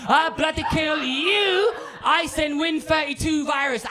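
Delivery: high, drawn-out comic threat; mock death threat. Troll's victim sending a death threat, high mock menace. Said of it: "he sends me a death threat".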